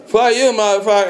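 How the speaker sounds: high, loud retold snarl at a stranger; drunken yell. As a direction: hurl it at him, picking a fight. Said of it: "And I said".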